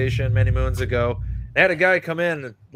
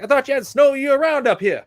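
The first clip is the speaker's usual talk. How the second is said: high, loud mock Southern accent; Southern accent, puzzled and loud; Southern tourist; 12 semitones higher, 4 dB louder than usual. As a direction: ham up a baffled Southerner. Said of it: "real thick Southern accent".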